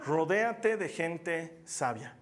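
each word laid out slowly; slow emphatic repeat. Shown as a demonstration. Preacher to congregation, slow weighty emphasis. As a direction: drive the point home slowly. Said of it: "Otra vez te lo digo".

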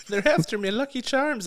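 high, sing-song Irish leprechaun lilt; Irish accent. Retold or imitated. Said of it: "the only time I can do an Irish accent".

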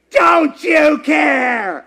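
slow, high, loud shout of despair; desperate cry. Retold or imitated. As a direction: drag it out like drowning men yelling. Drowning disciples cry out to Jesus.

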